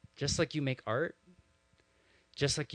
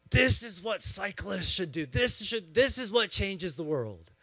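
raised-pitch, emphatic declaration; imitated impassioned rant; 6 semitones higher, 4 dB louder than usual. Pastor as his friend holding up the newspaper, impassioned imitated rant. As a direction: proclaim it high and forcefully, as the worked-up friend. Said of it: "he's, like, holding this New York Times".

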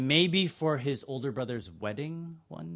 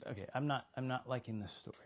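very soft grumble, as if to oneself; uneasy muttering; 3 semitones lower, 11 dB quieter, faster than usual. Acted. Uneasy crowd reacts to Jesus's story, muttering. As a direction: mutter your disapproval under your breath.

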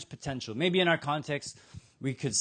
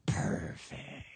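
smug whisper; 7 dB quieter than usual. Retold or imitated. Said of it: "the religious people are like".

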